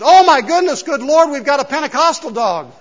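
high, loud shocked exclamation; the wife gasping in mock horror. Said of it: "the wife lets out a gasp and says".

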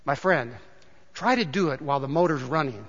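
doctor gently putting the mechanic in his place; dry punchline. Retold or imitated. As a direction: deliver the punchline calm and low.

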